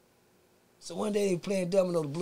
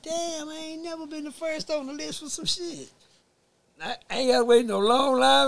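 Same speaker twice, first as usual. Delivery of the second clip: high, excited disbelief, louder at the end; crowing about being first for once; pleased surprise; 11 semitones higher, 6 dB louder than usual. Retold or imitated. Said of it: "I'm like".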